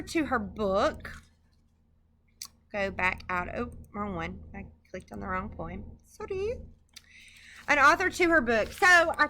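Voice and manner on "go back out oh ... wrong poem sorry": low-volume talking to oneself; quiet aside. Real, not acted. Lecturer clicking the wrong poem, quiet apologetic aside. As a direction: say it softly, half to yourself.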